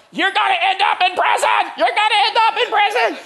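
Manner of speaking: high, loud mock yell of a furious mother; mom yelling. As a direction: yell it like a mom mid-spanking. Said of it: "yelling the whole time".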